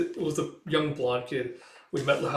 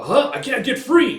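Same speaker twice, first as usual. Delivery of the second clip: high, loud cry of alarm; panicked yell; 11 semitones higher, 9 dB louder than usual. Retold or imitated. Character tied to the falling ship, panicked yell. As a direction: act out a trapped character yelling. Same stuck character, acted again.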